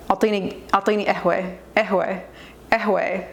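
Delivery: قهوة said "ehway", no q. Shown as a demonstration. Ordering coffee the Lebanese way, قهوة as "ehway". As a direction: drop the ق in قهوة and end on "ay". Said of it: "they don't pronounce the qaf".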